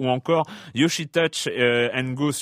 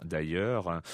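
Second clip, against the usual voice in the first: much lower, softer repeat; low aside; 9 semitones lower, 8 dB quieter than usual. Shown as a demonstration. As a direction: drop it into a low murmur.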